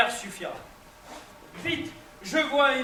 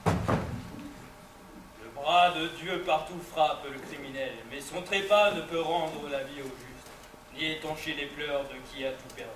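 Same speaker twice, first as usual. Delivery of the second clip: low mournful lament; 8 semitones lower, 6 dB quieter than usual. Acted. Grieving lament over dead wife.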